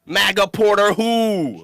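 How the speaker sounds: drawn-out mocking who; mock dismissive. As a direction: twist the name while scoffing at him. Repeats the taunt with a twist.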